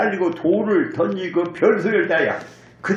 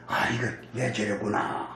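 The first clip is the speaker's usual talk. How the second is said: quiet realization; 9 semitones lower, 8 dB quieter than usual. Retold or imitated. David realizing his sin.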